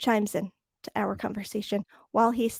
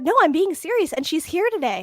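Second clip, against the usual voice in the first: higher, louder mock protest; retold insistence; 6 semitones higher, 4 dB louder than usual. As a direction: imitate him insisting he means it. Quotes man insisting he is serious.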